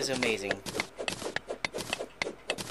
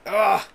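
loud, high frustrated grunt; frustrated ugh; 6 semitones higher, 9 dB louder than usual. Real, not acted. Gamer fed up after dying, loud frustrated grunt. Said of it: "A little frustrated".

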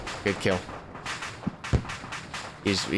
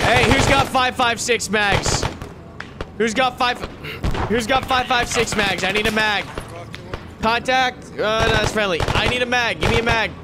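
shouting for ammo; 5 semitones higher than usual. Real, not acted. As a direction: yell across the firefight.

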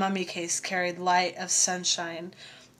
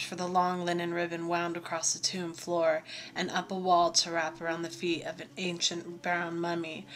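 usual speaking voice

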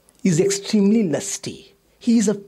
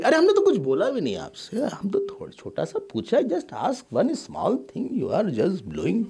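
rattled off, very fast defensive complaint; hurried protest; 5 semitones higher, 3 dB quieter, much faster than usual. Retold or imitated. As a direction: innocent party rushing to explain. Voicing hurt relative, very fast.

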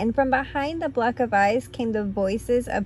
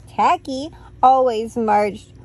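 plain reading voice

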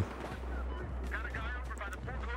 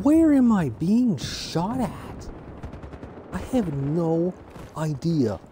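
much higher, slow, stretched-out confusion; exasperated whine; 6 semitones higher, slower than usual. Real, not acted. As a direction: whine it up high like you can't believe it. Getting shot by unseen enemy.

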